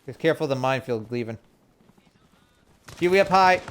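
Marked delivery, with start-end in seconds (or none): 3.0-3.6 calling out. Real, not acted. Calling out a helicopter.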